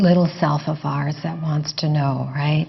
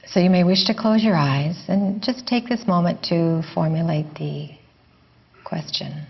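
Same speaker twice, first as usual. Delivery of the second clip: guided meditation. Real, not acted. Teacher guiding listeners to let the question go, guided meditation. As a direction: ease them gently inward, leaving space after each phrase.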